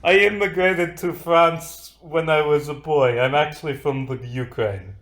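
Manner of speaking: put-on lightly held Russian accent; a hint of Russian accent, explaining. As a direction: keep the mild Russian accent going. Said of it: "That is Russian, not French".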